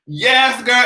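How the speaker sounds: usual speaking voice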